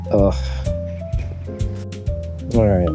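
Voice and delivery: normal talking voice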